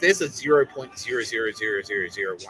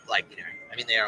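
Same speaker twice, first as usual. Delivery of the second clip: usual speaking voice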